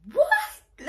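sudden high, loud what; outraged what. Retold or imitated. Married woman hearing a pass, high shocked shriek. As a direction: shriek it in disbelief.

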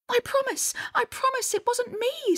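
put-on very high pleading girl's voice; pleading Inga. A narrator's reading. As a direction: protest innocence in a high voice. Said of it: "said Inga".

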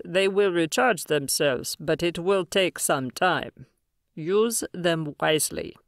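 slow, measured alien elder; slow alien leader voice. Acted. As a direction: hand it over with slow gravity. Alien leader gives devices.

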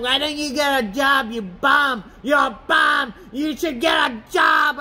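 high mock shout of an angry commenter; mocking angry heckler. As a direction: yell it like a nasty stranger. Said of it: "are mad and angry at me".

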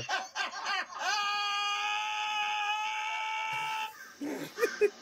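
high, screeching burst of laughter; powerful laugh. Real, not acted. Cracking up hard at a clip, shrieking laugh. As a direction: let out a huge shrieking laugh. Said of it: "Damn, that's a powerful laugh".

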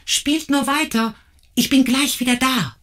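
cheerful call into the distance. Acted. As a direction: shout back across the garden. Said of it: "rief sie diesen zu".

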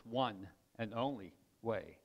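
"only" leaned on as the key word; one and only, with "only" stressed. Shown as a demonstration.

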